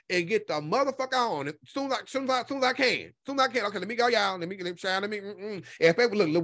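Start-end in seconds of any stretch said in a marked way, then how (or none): none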